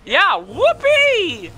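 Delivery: slow, drawn-out fake celebration; mock enthusiasm, dragged out; sarcastic cheer. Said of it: "Nah, I'm just kidding".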